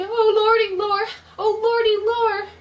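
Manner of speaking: high, loud fearful moaning; terrified moan. Acted. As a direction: moan out in terror. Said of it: "the gravedigger moaned".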